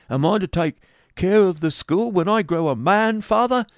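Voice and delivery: put-on high, loud, eager boy's voice; delighted child. A narrator's reading. Young son asks father about running the school, delighted. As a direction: pipe up with excess of delight. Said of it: "in the excess of his delight".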